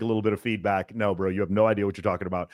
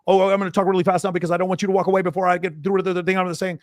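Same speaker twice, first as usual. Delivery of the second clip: imitating a rushed, higher nervous voice; nervous fast talker; 8 semitones higher, 3 dB louder than usual. Coach imitating a nervous guy talking to women, rushed and higher. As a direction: rush it out, afraid she'll walk away. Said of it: "And they start talking very fast".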